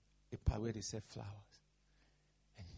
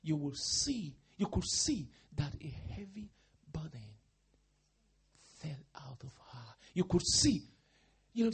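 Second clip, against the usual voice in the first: ordinary delivery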